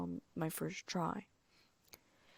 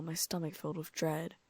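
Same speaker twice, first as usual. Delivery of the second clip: plain reading voice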